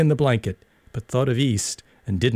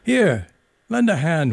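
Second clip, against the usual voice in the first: higher, louder call to his mates; calling for help; 6 semitones higher, 3 dB louder than usual. A narrator's reading. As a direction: shout the boys over, high and loud. Flashman calls for help.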